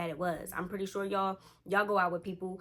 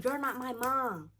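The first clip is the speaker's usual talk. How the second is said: slow, higher, punchy mock snap; snapping back; 6 semitones higher, 6 dB louder, slower than usual. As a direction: bite back at the nagging sitter, word by word. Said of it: "[name] snaps on".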